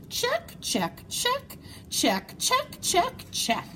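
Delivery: sing-song high check, check; high squeaky checks. Acted. Moose ticking off his list, high and chirpy.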